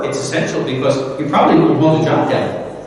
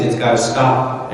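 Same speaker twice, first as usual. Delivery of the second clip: ordinary talk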